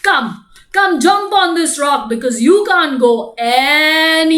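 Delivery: slow, loud crocodile threat; gloating hungry crocodile. A narrator's reading. Crocodile taunts trapped monkey on the tree, slow loud gloating. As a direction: draw it out loudly, savouring the trap.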